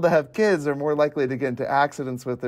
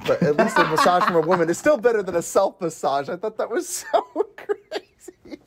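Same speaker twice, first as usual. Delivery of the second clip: laughing; 4 semitones higher than usual. Real, not acted. Mocking the massage scene line, laughing. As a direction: crack up while saying it.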